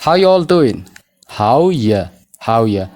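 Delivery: "How ya all doing", are dropped and you reduced to ya; no R sound. Shown as a demonstration. Teacher showing the R is absent, reduced and linked. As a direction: link "How ya" with no R between. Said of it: "R kan jedhu as keessa hin jiru".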